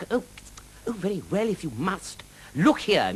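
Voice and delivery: high-pitched huffy knight voice; indignant knight. A narrator's reading. Knight confronts the dragon over his burnt moustache, indignant.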